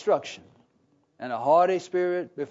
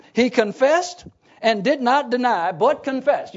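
loud, raised emphatic scripture reading; 5 semitones higher, 6 dB louder than usual. A narrator's reading. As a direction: drive home each verb of the confession. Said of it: "he was emphatic, wasn't he?".

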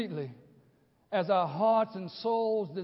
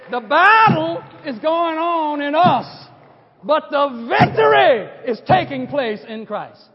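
louder and slow, shouting the word victory; preached loud; 8 semitones higher, 13 dB louder, slower than usual. Shown as a demonstration. Preacher quoting his own point to the congregation, slow booming proclamation. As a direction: proclaim it like a battle cry. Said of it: "This is the way I said it".

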